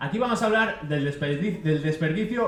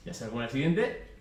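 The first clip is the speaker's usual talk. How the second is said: ordinary delivery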